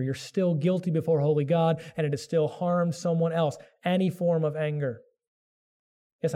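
usual speaking voice

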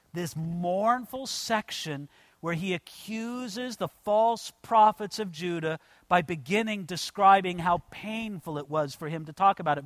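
ordinary talk

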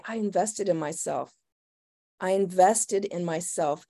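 soft and low, relishing it; quiet, savoring. Shown as a demonstration. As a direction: savor each word quietly. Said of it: "you say".

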